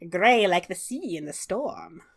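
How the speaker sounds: slow, musing hag voice. Acted. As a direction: savor each word like a hag. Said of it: "She says".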